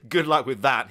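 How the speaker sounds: loud, high mocking laughter through the words. A narrator's reading. Father laughing at his son's plan. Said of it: "let out a loud laugh".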